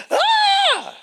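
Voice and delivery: piercing high loud scream; child's scream. Acted. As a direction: let out a sudden child's shriek.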